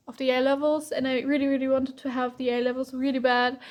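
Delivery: ordinary delivery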